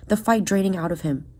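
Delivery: plain reading voice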